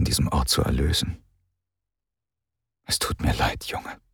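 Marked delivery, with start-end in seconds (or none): 2.8-4.0 soft, breathy inner voice; quiet sorrowful thought; hushed inner thought. A narrator's reading. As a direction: think it almost silently, full of regret. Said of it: "dachte Dilith".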